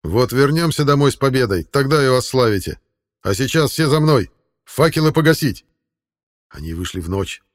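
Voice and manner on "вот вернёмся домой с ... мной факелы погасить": loud, rising orders; commanding. Acted. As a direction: lead them out with authority. King commanding the breakout.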